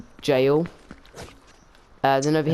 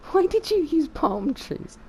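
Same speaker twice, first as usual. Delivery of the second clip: high-pitched, slow disbelief; baffled; slower than usual. Real, not acted. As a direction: question your friend's choice, high and drawn-out. Baffled by friend's palm trees.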